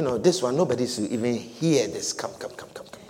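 hushed, unvoiced beckoning; secretive whisper. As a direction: whisper him aside so nobody hears. Pastor voices Peter pulling Jesus aside.

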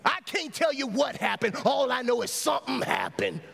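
high, ringing preacher's cry; fervent testimony at full force; impassioned preaching. Real, not acted. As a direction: testify to the congregation, voice lifted high.

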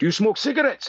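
high South African question; South African accent. Retold or imitated. Cigar teacher checking on his student, South African accent. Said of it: "with a South African accent".

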